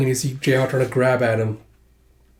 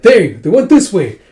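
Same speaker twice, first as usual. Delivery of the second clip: calling out; 7 semitones higher, 9 dB louder, slower than usual. Retold or imitated. Enemy soldiers calling out in chase.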